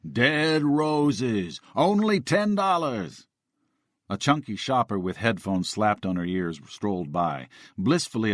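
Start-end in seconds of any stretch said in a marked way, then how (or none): 0.1-3.3 lightly voiced muffled zombie drone; costumed zombie selling DVDs to passers-by, droning. A narrator's reading. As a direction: hawk the DVD in a zombie drone. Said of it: "the zombie droned".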